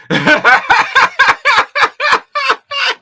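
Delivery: loud high cackling laugh; burst of high laughter. Real, not acted. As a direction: crack up loudly.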